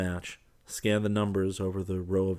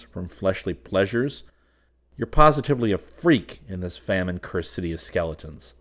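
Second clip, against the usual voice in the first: loud, emphatic telling-off; exasperated scolding; 2 semitones higher, 11 dB louder than usual. A narrator's reading. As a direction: lay into a spendthrift friend, loudly. Trent scolds spendthrift friend Fallowby.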